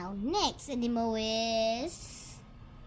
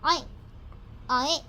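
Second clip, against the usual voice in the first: pig oinks. Acted. Imitating a pig.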